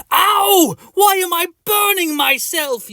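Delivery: high, loud burnt yelp; scalded cry of pain; pained yell. Acted. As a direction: yelp as the hot water bites. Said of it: "you scream".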